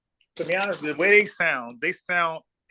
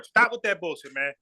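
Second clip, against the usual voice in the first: ordinary delivery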